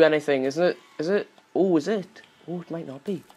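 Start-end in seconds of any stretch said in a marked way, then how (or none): none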